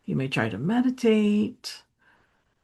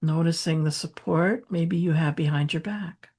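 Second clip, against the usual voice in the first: unhurried, softening meditation voice with long pauses; gentle grounding guidance, hushed by the end; guided meditation; 4 dB quieter than usual. Real, not acted. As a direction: ease them into stillness, growing softer toward a near whisper.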